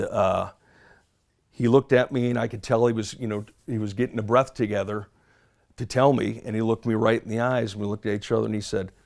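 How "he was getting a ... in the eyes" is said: ordinary talk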